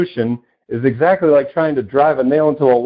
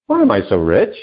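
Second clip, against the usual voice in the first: normal talking voice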